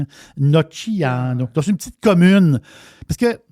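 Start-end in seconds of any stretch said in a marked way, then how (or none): 0.4-1.5 drawn-out Italian pronunciation; Italian accent. Shown as a demonstration. Host repeating the town's name proudly, slow Italian accent. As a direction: stretch the town name out in Italian. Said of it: "Pas pire mon accent, euh, italien".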